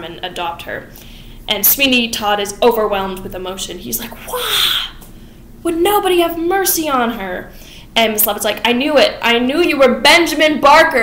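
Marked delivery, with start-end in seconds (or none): 4.3-7.5 anguished cry. Retold or imitated. Narrator voicing Sweeney grieving for his wife, high hammed-up wail. 8.7-11.0 louder, higher triumphant gasp; excited Mrs Lovett. Retold or imitated. Narrator voicing Mrs Lovett recognizing Sweeney, loud and excited.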